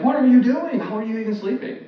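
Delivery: high, loud outcry of disbelief; shrieky acted alarm, easing at the end; mock screaming panic. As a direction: imitate frantic men yelling at a sleeper. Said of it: "they're screaming".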